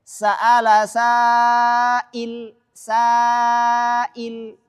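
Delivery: "sa'il" with a very long, light, open "saa"; drawn-out recited "sa'il", vowel kept light; thin alif. Shown as a demonstration. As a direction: stretch the thin first vowel of "sa'il" on a level note. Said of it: "maka bacanya tipis".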